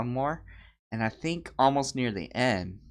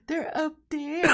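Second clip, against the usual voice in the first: slow, high mock whimper; crying little boy; 4 dB louder than usual. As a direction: whine it like a tearful small child.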